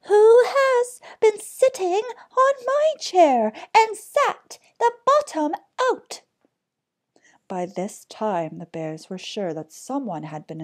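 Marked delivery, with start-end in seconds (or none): 0.0-6.2 very high, loud little bear; furious tiny bear, piping high and loud; teeny tiny angry voice. A narrator's reading. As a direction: squeal it in tiny outrage. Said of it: "in a teeny tiny voice of anger".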